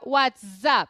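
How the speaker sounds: "What's up" with the s run into "up"; teacher's linked demo of "What's up"; linked pronunciation. Shown as a demonstration. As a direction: say "What's up" as "what-sup". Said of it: "juntar o S do what's com o U do up".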